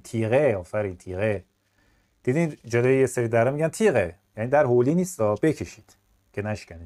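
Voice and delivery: normal talking voice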